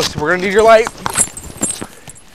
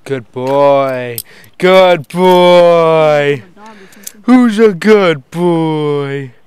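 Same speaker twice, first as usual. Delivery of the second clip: praising a dog; 9 dB louder, much slower than usual. Real, not acted.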